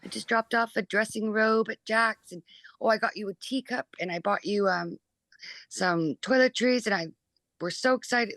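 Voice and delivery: imitating an English mother's accent, excited. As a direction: put on her English accent and gush about the gifts. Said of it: "it's not an accent to you guys".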